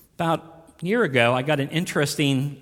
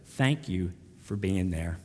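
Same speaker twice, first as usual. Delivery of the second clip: softer, slow and lower, a grateful close; tender thanks; 8 semitones lower, 7 dB quieter, slower than usual. Retold or imitated. Couple thanking their old pastor in a letter, tender. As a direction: let the thanks land gently.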